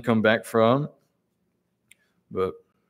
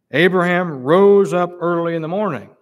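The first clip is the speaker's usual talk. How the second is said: higher and louder, stressing "rose"; emphatic preaching; 5 semitones higher, 5 dB louder than usual. Acted. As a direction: lift the whole line and hit "rose". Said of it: "highlight these words".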